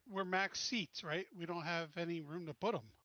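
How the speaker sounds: ordinary delivery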